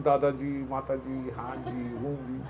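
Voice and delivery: very soft, fawning courtesy; mock deferential murmur; overly polite. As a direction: murmur sweet respectful replies. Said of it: "सब चल रहा है".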